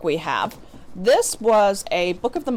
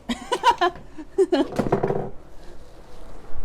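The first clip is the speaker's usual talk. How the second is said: real laugh; 11 semitones higher than usual. Real, not acted. Laughing at cat interrupting.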